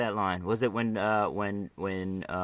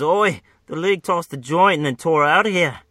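put-on half-hearted Australian accent, higher and louder; a failed stab at an Australian Boomerang; weak Australian accent; 7 semitones higher, 8 dB louder than usual. Reading Boomerang's comic line in a shaky Australian accent. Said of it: "That was not fucking Aust".

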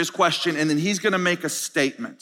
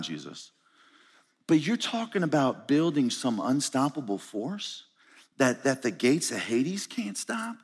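low, soft, nervous disciple; a buddy pulling Jesus aside quietly; hushed worried disciple; 4 semitones lower, 5 dB quieter than usual. Acted. Disciple quietly pleading with Jesus, low and hushed.

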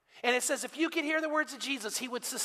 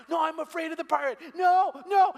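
higher, then loud, fearful cries of no; frightened toddler; 7 semitones higher, 6 dB louder than usual. Retold or imitated. Caroline refusing to see the pirate, higher scared toddler wail. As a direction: imitate a scared two-year-old refusing. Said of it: "And she cries and doesn't wanna go".

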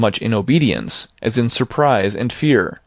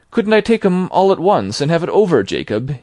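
hinting; 7 semitones higher than usual. A narrator's reading. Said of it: "hinted Scrooge".

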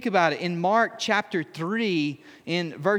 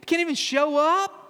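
high, squeaky, disbelieving whine; incredulous David; 7 semitones higher than usual. Retold or imitated. David protesting his brothers' scorn, incredulous whine. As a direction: play it like a wounded little brother. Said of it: "David's like".

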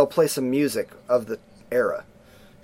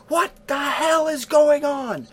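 much higher, slow mock mom yell; 13 semitones higher, slower than usual. Mom outside the door, mock angry yell. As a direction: shout through the door like an angry mother. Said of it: "you hear the mom outside the door go".

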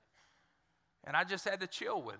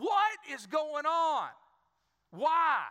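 slow, raised, pleading question; desperate plea; 7 semitones higher, 5 dB louder, much slower than usual. Retold or imitated. Pastor reliving his despair, pleading with God. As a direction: drag it out in despair.

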